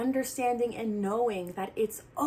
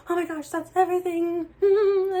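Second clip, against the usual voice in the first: panicked; 9 semitones higher, 6 dB louder than usual. Retold or imitated. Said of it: "I thought".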